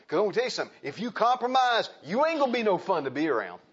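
high, loud preacher's warning; full-force preaching. Real, not acted. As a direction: hammer the warning home.

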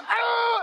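short, high baboon scream; loud, high-pitched animal roar; baboon yell. Retold or imitated. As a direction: imitate a baboon yelling at someone. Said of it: "he's yelling at me".

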